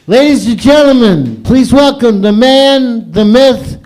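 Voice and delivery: loud, high emcee intro; host announcement. Real, not acted. Host introducing the next comedian, loud and high. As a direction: build up the next act like a ring announcer.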